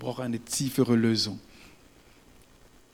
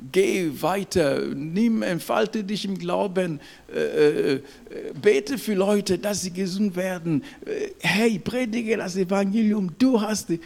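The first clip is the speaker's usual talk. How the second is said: higher-pitched rousing appeal; urgent exhortation; 5 semitones higher than usual. Acted. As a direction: urge the believer onward as God would. Voicing God urging believers on. (eindringlich anfeuernd, mit höherer Stimme)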